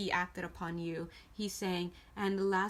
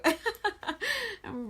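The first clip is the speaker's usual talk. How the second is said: laughing out loud at her own joke; loud high laugh; 12 semitones higher, 4 dB louder than usual. Real, not acted. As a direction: burst of high giggling.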